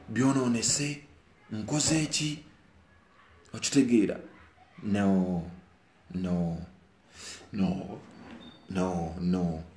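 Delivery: ordinary delivery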